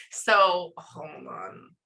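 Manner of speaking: ordinary delivery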